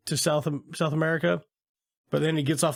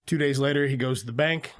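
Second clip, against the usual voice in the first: ordinary talk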